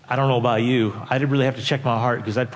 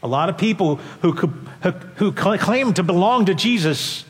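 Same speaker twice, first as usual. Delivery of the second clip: climbing higher and louder with each phrase; rising preaching; 8 semitones higher, 4 dB louder than usual. Acted. Preacher pressing the congregation with rising fervour. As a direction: preach it up to full force. Preacher building to full force.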